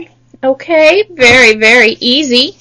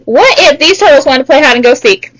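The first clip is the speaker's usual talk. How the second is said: ordinary talk, nothing marked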